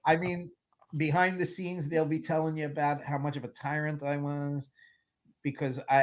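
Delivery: ordinary talk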